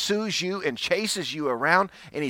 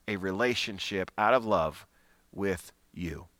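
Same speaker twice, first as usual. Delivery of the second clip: tender preaching; 5 semitones lower than usual. Acted. Tender close of sermon.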